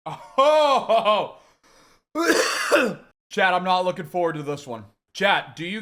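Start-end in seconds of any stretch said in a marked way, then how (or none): 0.1-3.0 high, loud burst of laughter; laughing. Real, not acted. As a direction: laugh out in relief. Laughing after the wing.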